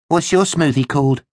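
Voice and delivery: high-pitched inquisitive question; curious schoolgirl. Acted. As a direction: peer over and ask with interest. Classmate Molly asks, curious.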